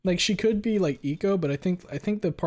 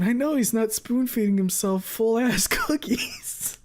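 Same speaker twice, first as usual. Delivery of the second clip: slow, higher, louder words through laughter; higher laughing incredulous quote; 10 semitones higher, 6 dB louder, slower than usual. Retold or imitated. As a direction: laugh in disbelief as you say it. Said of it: "I'm sitting there like".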